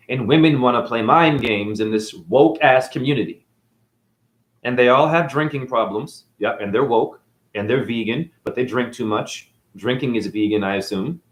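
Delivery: usual speaking voice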